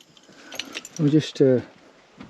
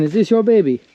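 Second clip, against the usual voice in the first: ordinary talk, nothing marked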